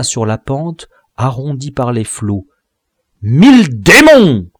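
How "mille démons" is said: loud, high roar; furious roar from the falling dwarf; roaring curse. Acted. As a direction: bellow it as he falls. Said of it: "s'écria-t-il en rugissant".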